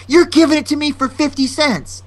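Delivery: high, insistent customer voice; demanding customer. Retold or imitated. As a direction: make an imitated demand at the counter. Customer demands a lower price.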